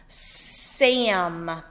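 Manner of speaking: Sam drawn out slowly; slow example word. Shown as a demonstration. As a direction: stretch Sam for the sound. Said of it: "We can find the S at the beginning".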